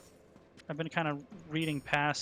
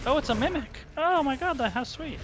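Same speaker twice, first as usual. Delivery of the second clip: high, squealing excitement; mock delight; 11 semitones higher, 5 dB louder than usual. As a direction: squeal like finding a treasure.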